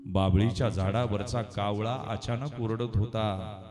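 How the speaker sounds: ordinary talk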